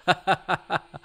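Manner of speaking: high, quick run of laughter; loud amused laugh. Real, not acted. Fan laughing at a cheap monster effect, loud and amused.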